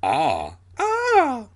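a short "ah", then a high-pitched shriek; two voices screaming in turn; two screams. A narrator's reading. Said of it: "Alex and I both screamed".

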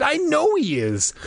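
high, emphatic certainty; insistent. A narrator's reading. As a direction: insist with pitch pushed right up. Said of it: "Margaret insisted".

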